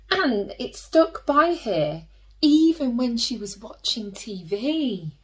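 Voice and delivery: ordinary delivery, nothing marked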